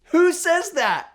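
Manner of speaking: high, loud incredulous squeal; gleeful who-says-that; delighted disbelief. Real, not acted. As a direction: marvel at it out loud. Incredulous delight at the waiter's phrase.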